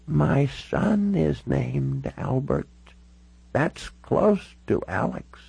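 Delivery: put-on high, very slow elderly voice; frail old lady dwelling on each word; very old woman. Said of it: "She looked all of ninety-six years".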